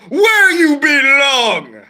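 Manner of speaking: very loud, high, long-held yell; hammed-up, hugely drawn-out decree; dramatic banishing shout. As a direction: wail the sentence out theatrically. Banishing the train, retake.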